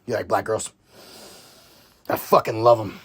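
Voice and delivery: low, gruff Sean Penn impression; mimicking Sean Penn. Fan quoting the store scene in a low, gruff voice. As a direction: drop way down into a growly, leering voice. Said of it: "He goes up to Leo in the store and he's like".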